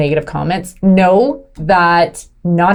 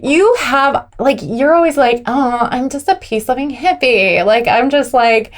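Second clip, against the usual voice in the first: put-on higher, dragged-out Valley girl hippie; 5 semitones higher than usual. Wife voicing husband mocking her, Valley girl. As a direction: mimic a ditzy peace-loving Valley girl. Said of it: "he's saying this like a Valley girl too".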